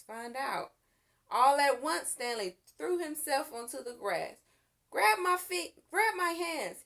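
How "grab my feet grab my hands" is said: higher, louder urgent command; urgent order. A narrator's reading. Boy telling his mother to grab him, urgent. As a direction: shout the plan fast. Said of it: "he told his mother".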